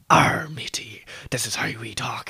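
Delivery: hammed-up pirate voice, big on the opening growl; pirate talk. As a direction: do a stock movie pirate. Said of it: "pirates talk".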